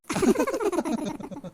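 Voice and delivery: high, rippling laugh; a long burst of amused laughter; hearty laughter. Acted. Family laughs at the young man's compliment to the cook, hearty.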